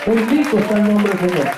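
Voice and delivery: normal talking voice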